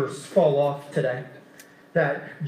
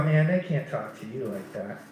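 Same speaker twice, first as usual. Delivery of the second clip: insinuating whisper; 8 semitones lower, 7 dB quieter than usual. Retold or imitated. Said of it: "Satan loves to whisper in your ear".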